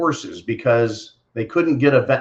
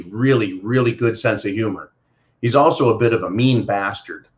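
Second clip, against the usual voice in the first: ordinary talk, nothing marked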